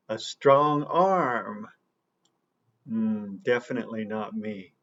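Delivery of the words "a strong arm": slow demo phrase. Shown as a demonstration. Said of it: "maybe we could say".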